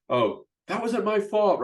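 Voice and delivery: high, whiny blame-dodging excuse; palms-up excuse. Retold or imitated. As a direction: play the player shrugging off the blame. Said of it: "We go palms up".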